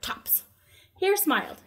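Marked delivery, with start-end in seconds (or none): 0.0-0.4 soft whisper; whispered aside. Shown as a demonstration. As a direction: whisper the word. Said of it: "Hare, right, tops".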